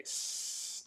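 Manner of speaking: soft unvoiced snake hiss; drawn-out s like a snake. Shown as a demonstration. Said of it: "an S sound like snake".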